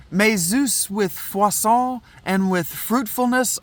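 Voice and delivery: put-on formal prayer recitation in own accent; declaimed verse. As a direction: read it as ceremonial verse. First take, before announced accent.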